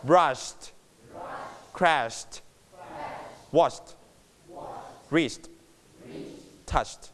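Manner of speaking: a word list, "brushed" to "touched", each closing on a quiet t; soft t endings on "brushed", "crashed", "washed", "reached", "touched"; light final t. Shown as a demonstration. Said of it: "ถือเบาๆนะครับ".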